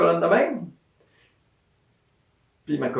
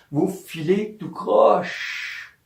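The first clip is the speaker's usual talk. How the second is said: Vous filez tout croche said very slowly, each word spaced; slow key phrase repeated; 6 semitones higher, much slower than usual. Shown as a demonstration. Healer repeating her key phrase to patients, very slowly. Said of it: "Voilà la petite phrase".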